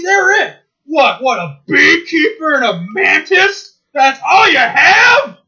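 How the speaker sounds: high, loud, slow furious rant; ranting cartoon villain. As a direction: scream at the host in a cartoon rage. Zorak ranting at Space Ghost.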